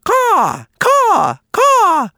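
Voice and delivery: high, cawing crow call; Blacky the Crow. A narrator's reading. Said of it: "Blacky the Crow calling".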